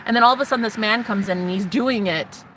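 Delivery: ordinary delivery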